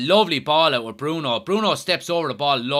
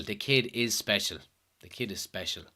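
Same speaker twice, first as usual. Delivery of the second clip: put-on repeating "The kid is special" softer and softer to himself; quiet echo; 6 semitones lower, 8 dB quieter than usual. Shown as a demonstration. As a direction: murmur "The kid is special" twice, fading each time.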